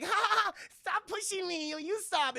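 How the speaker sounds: very high, giggly put-on voice; mock girly squabble. Sonya and Mileena pushing in mud, high mock giggly squeal. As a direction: squeal playfully, hammed up.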